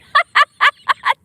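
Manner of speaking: very high, loud shriek of laughter. Real, not acted. Laughing at the catfish's photo, shrieking. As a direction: let out a piercing laugh.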